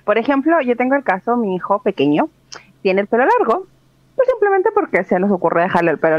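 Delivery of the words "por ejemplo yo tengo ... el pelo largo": ordinary talk, nothing marked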